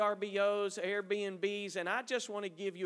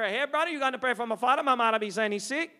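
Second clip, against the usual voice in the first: loud, quick, raised call; hollering from the pews; 4 semitones higher, 8 dB louder, faster than usual. Retold or imitated. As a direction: shout a request across the church. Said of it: "somebody may holler".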